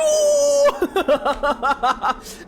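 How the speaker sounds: high, loud mocking cackle. Real, not acted. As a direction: cackle at the villain's stupidity. Streamer mocks villain's monologue.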